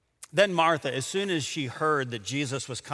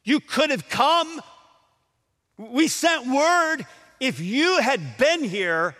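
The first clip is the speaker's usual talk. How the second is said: sobbing accusation; 7 semitones higher, 5 dB louder, slower than usual. Retold or imitated. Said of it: "in the sobs of her voice".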